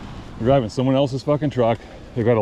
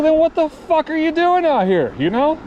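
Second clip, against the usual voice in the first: much higher, exasperated retelling of his own rant; heated retort; 12 semitones higher than usual. Retold or imitated. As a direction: re-enact the outburst at the driver, pitched way up. Retelling his heated roadside argument.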